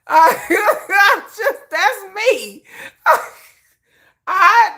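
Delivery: high, loud laughing through words. Real, not acted. Laughing hard at her own fear, high and loud.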